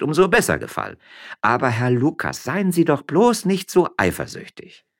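high, lively miller's wife; Frasquita voice. A narrator's reading. Frasquita teasing her husband up in the vine, high. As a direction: pitch up, playful and teasing.

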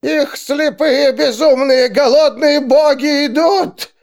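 high, loud, slow trance rant; ranting seer. A narrator's reading. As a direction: howl like a possessed seer.

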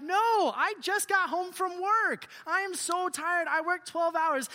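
mock exasperated father, loudly refusing; refusing dad. Acted tired dad refusing his kid.

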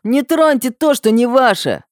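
high-pitched indignant shout. A narrator's reading. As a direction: yell it from the crowd, high. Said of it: "недовольные крики парней".

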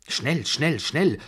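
rapid-fire imperious command. A narrator's reading. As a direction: bark it out like an impatient old matriarch. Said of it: "kommandierte die Babuschka".

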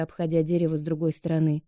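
ordinary delivery, nothing marked

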